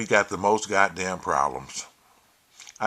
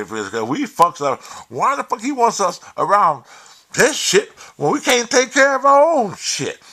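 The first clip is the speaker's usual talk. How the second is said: much higher and louder, imitating a furious rant; ranting caller; 7 semitones higher, 7 dB louder than usual. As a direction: ham up the caller going off. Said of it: "he was going off".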